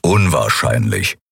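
low, slow grumbling voice; brummend. A narrator's reading. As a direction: grumble it, deep and unimpressed. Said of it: "brummte Keru". (tiefes, langsames Brummen)

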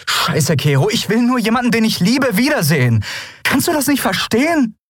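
high-pitched, impassioned plea; desperate outburst. A narrator's reading. Endriel pleads with Kero for her loved one, desperate outburst. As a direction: burst out, demanding to be understood.

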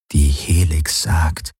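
slow, doubtful repeat of his words; sceptical echo. A narrator's reading. Companion echoing the pilot's claim about the Helix, sceptical. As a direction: draw it out slowly, unconvinced.

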